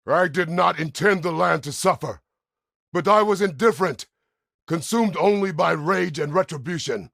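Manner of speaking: loud, high-pitched outburst; impassioned shouted confession. Acted. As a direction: shout it out in full earnest. Anguished confession of past deeds.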